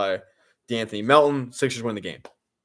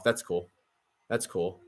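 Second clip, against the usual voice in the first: deadpan sarcasm; 4 semitones lower, 7 dB quieter than usual. Real, not acted. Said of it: "I'm very, like, deadpan".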